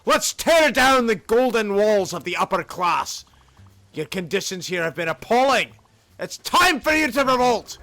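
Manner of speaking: rousing Scottish rally speech, key words punched; rally the workers in a Scottish accent; Scottish freedom fighter. Acted. Union organizer urging workers to revolt, rousing Scottish accent. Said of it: "Scottish freedom guy".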